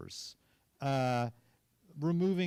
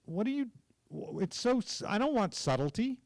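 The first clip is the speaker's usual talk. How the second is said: higher-pitched, stammering objection; retold exasperated director; 9 semitones higher than usual. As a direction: imitate a director groping for words. Director rejects the subtle performance.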